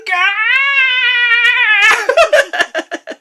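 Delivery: shrieking laughter; long high laugh. Real, not acted. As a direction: cackle out loud.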